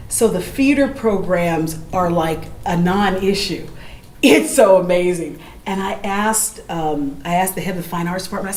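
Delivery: ordinary delivery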